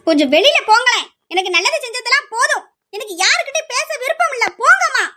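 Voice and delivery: angry refusal. Acted. Daughter shouts at mother and sister to leave, rising angry. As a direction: push them all out of the room.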